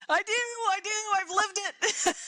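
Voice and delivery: breaking into a laugh at the end; amused. Real, not acted. Speaker owning up to the interviewer, amused and high-pitched. Amused reply to listener's remark.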